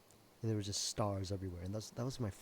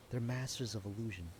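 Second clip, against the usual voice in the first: ordinary talk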